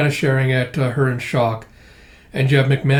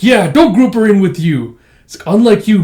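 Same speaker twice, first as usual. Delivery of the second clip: raised, louder mock objection; indignant retort; 5 semitones higher, 6 dB louder than usual. Defending Millie against Yor's comparison, indignant. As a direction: push back hotly on a friend's behalf.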